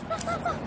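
high yappy woofs like a little dog; dog barking. Pretend. Child singing about her dog, barking high like a puppy.

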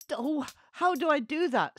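high, loud flustered cry. A narrator's reading. Freddy upside down in the bubble, flustered. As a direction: panic at the instruction.

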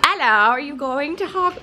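a hint of a put-on British accent; bad British accent. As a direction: try a clumsy British accent. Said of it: "that's a terrible accent".

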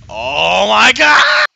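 slow, loud stunned yell; stretched-out shocked Oh my God; shocked shout. Real, not acted. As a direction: scream it at the blast. Fan on setting three blasts.

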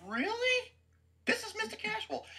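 high, disbelieving "Really?"; sarcastic disbelief at Bennett; incredulous really. Retold or imitated. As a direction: sound stunned and doubtful. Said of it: "I'm like".

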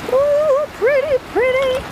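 high, sing-song cooing delight; delighted squeal. Real, not acted. Beachcomber cooing over a shell find, delighted. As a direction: coo over it in a high pitched voice.

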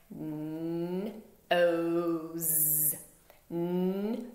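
N-o's said as separate sounds, n then ose; sounds chopped apart. Shown as a demonstration. Teacher chopping N-o's apart for the class. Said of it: "So chop it with me".

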